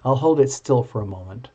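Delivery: ordinary delivery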